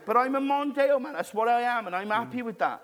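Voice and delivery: high, loud, laughing Welsh boast; mock Welsh drunk. Drunk Welsh guest insisting to his mate, laughing Welsh accent. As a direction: crack up while doing the Welsh bloke. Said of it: "that sounds Welsh".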